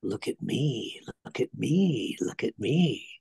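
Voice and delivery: softly whispered, leaning in close; hushed intimate whisper. Retold or imitated. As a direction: breathe it to him like a secret. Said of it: "Olivier leans into him and goes".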